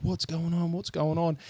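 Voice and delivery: lightly voiced mum rushing in to ask what's happening; alarmed foster mum.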